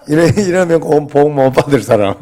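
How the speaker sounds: playful scolding that breaks into laughter; laughing tease. Real, not acted. Pastor teasing the congregation for not laughing along, laughing.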